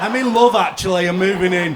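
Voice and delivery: loud, high mock-triumphant outburst; shouted declaration. Tenant seizing the excuse to move in, shouted declaration. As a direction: blurt it out at full volume. Acted outburst to landlord's sale.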